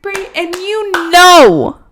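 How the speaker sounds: loud, hyped segment intro; game show announcer. Acted.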